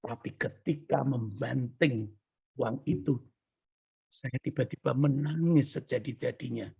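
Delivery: low, soft, slowed storytelling; hushed solemnity. Real, not acted. Preacher tells how he suddenly wept, hushed. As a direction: drop right down for the solemn turn.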